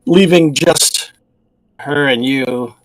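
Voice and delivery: ordinary talk, nothing marked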